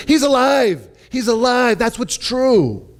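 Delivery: high, emphatic announcement; acted-out excited witness; breathless excitement. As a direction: proclaim the astonishing news. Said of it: "they're out of breath".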